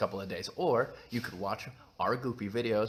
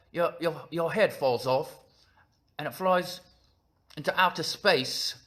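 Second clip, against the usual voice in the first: higher-pitched tough-guy British threat; put-on Cockney action hero, punchy phrases; Jason Statham; 5 semitones higher, 3 dB louder than usual. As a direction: play a British hard man making silly threats. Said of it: "You talk a little bit like Jason Statham".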